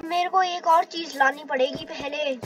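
ordinary delivery

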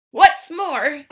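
loud, sharp retort; snapped. Acted. Lorax snaps at the Onceler, loud and sharp. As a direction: snap the words out hard. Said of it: "snapped the Lorax".

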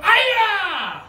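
high, loud karate shout; karate yell. Shown as a demonstration. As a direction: yell it with the whole class. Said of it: "Everyone go".

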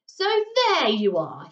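a touch of a menacing giant calling out; giant cries out. A narrator's reading. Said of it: "he cried".

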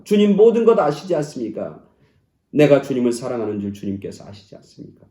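loud, high, driving sermon voice; fervent preaching. Acted. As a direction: preach it out to the whole church. Loud preaching at full force.

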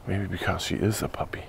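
soft unvoiced whisper; whispered aside. Real, not acted. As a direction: murmur it under your breath. Aside while training dog.

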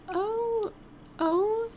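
high startled oh oh, then a long gasp; shocked gasp. Real, not acted. Gamer watching her Sim get smited, gasps in shock.

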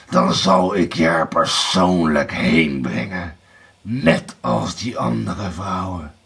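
low, deliberate threat; menacing Bluebeard. A narrator's reading. Blauwbaard promises Erika her punishment, menacingly.

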